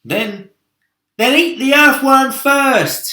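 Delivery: high, loud, slow, gleeful urging; shout. A narrator's reading. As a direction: yell the suggestion. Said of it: "shouted the centipede".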